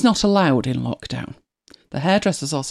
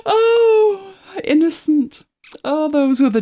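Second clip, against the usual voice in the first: mock wistful sigh; 10 semitones higher, slower than usual. Real, not acted. Wistful about early lockdown.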